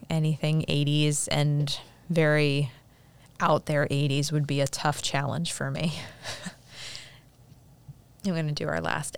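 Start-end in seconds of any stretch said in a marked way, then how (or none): none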